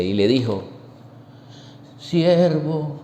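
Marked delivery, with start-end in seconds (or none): 2.0-3.0 sad voice. Acted. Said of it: "vamos a hacer la voz triste".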